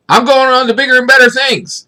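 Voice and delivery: mock upbeat farewell. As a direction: sell it like a happy exit that never happened. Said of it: "this was not a speech where he was like".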